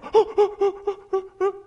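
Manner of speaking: put-on high, loud, laughing oh-oh-oh of a child dying to answer; eager kid. Preacher mimics an eager child raising a hand: high, loud, laughing. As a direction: mimic a kid bursting to be picked. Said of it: "And they'll go".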